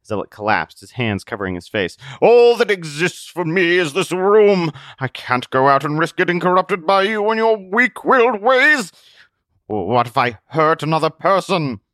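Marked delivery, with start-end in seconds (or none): 2.2-11.8 high, loud, anguished recluse; despairing hermit. Acted. Zealot explaining why he hides, anguished hermit voice. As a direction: plead your fear of going out.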